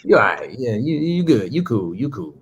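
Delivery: low, trailing-off faint praise; grudging half compliment. Retold or imitated. Imitating someone grudgingly praising a comedian, half-hearted compliment. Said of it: "give you a half compliment and then shoot you down".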